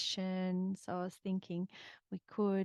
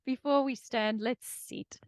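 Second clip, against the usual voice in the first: laughing; 6 semitones higher, 7 dB louder than usual. Real, not acted.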